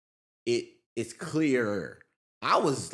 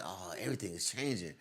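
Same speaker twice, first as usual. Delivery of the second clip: low quiet; 6 semitones lower, 11 dB quieter than usual. Retold or imitated. Inner thought, voiced low.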